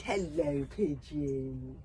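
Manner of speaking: plain reading voice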